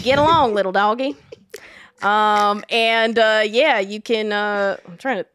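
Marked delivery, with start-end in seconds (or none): 0.0-1.1 a touch louder, hammed-up Southern cowboy call; lightly voiced Southern cowhand calling the herd; Southern accent. Guest answers the host's cue in a Southern cowboy accent. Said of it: "in my thickest, uh, Southern accent".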